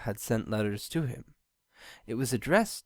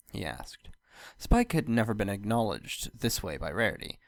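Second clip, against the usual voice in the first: usual speaking voice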